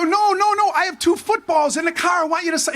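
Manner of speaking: high, hurried denial; embarrassed backpedal, voice up high; flustered correction. Retold or imitated. Correcting McMahon's joke about the balls, flustered. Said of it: "I go".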